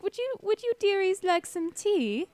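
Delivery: put-on high, sweet old lady offer; granny fussing over her guests; high-pitched doting old-woman voice; old woman, hospitable. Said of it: "and is like".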